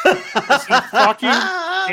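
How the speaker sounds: high, loud laughing burst; laughing outburst. Real, not acted. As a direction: break into laughter mid-word.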